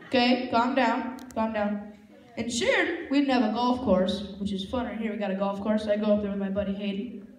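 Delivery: ordinary talk, nothing marked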